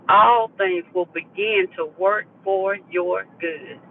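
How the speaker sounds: slow, each word weighted; emphatic repeat. Real, not acted. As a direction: say it slowly and deliberately, leaning on ALL. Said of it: "Let me say that again".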